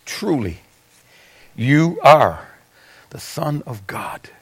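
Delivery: whispered, slow and hushed; awed whisper. Retold or imitated. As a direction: breathe it out in awe.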